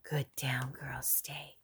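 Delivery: soft whispered praise and stay. Real, not acted. Owner whispering praise so the puppy stays down. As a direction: whisper it so she holds still.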